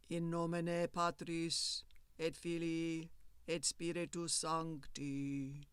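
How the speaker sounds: slow, soft, distant Latin intoning; solemn priest intoning Latin slowly and softly; heavy, sonorous monk's voice. A narrator's reading. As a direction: intone it slowly, like a monk heard from far off behind a door. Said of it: "spoke in heavy, sonorous tones".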